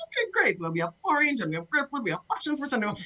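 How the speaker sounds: high, sing-song waitress voice; chirpy mock waitress. Waitress pestering her customer, high and sing-song.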